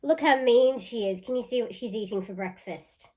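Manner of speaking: ordinary talk, nothing marked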